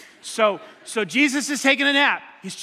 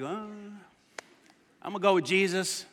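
sleepy, unbothered; 4 semitones lower, 7 dB quieter, slower than usual. Retold or imitated.

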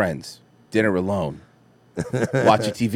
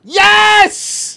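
excited cheer; 6 dB louder than usual. Real, not acted. Cheering a winning pick.

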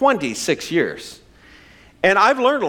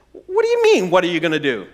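mock-affronted, pitched way up at first; challenging wife. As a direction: imitate a wife demanding an explanation. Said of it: "if she comes back and says".

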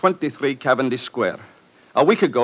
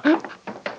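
a high, breathless gasp of pain; sudden gasp of chest pain; sharp gasp; 14 semitones higher, 4 dB quieter than usual. Acted. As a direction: catch your breath as the heart seizes. Heart attack onset, gasping.

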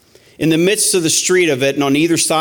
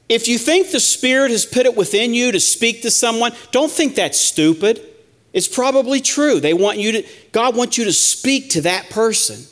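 high, pleading sermon voice; impassioned preaching; 7 semitones higher than usual. Acted. Preacher urging the church to speak the word, impassioned. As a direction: urge the church not to be afraid.

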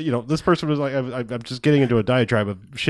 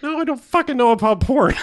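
mock outrage; 11 semitones higher than usual. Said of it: "I'm like".

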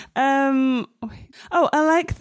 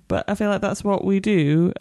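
normal talking voice

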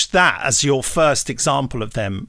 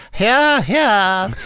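mock parliamentary cheer; 8 semitones higher, 3 dB louder than usual. MPs cheering in the Commons.

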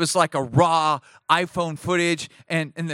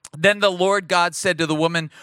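usual speaking voice

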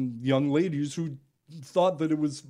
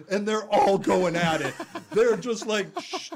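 ordinary delivery

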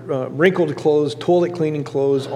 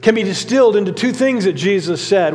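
normal talking voice

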